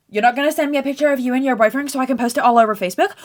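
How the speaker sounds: fast, high, loud mum impression; mock nagging mum. Mum demanding a photo of the boyfriend, mock nagging. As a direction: rattle it off as a nagging mother. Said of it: "My mum's already being a raging fucking bitch".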